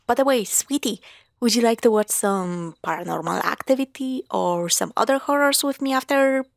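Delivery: normal talking voice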